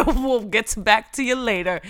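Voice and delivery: loud, laughing delivery; joking, breaking up with laughter. Real, not acted. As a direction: laugh your way through the words. Laughs through joke about bills.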